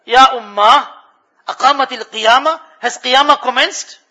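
high, loud anxious question; a child asking if the world is ending; frightened child. Retold or imitated. Little child asking mother in alarm, high and loud.

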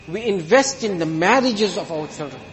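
usual speaking voice

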